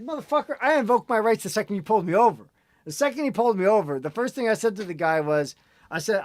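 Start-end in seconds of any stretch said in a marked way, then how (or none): 0.0-2.5 indignant outburst. Retold or imitated. Inner outburst at the cop.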